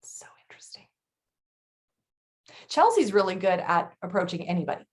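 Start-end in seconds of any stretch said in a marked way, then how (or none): 0.0-0.8 soft breathy aside; whispering. Real, not acted. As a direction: whisper it to yourself. Aside after a long pause.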